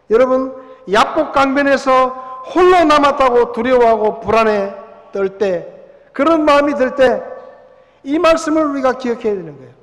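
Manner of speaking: loud, ringing preacher voice; preaching at full force. Acted. Pastor to his congregation, loud fervent preaching. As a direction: proclaim it to the whole hall.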